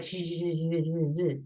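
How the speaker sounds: low buzzing zaps; stuttering string of electric zzz sounds; imitated electrical buzzing. Shown as a demonstration. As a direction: buzz like loose electric current. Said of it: "whole house would be like".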